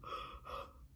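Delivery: a soft catching gasp; tearful gasp. Real, not acted. Driving home a wreck, a tearful gasp. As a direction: catch your breath mid-sob.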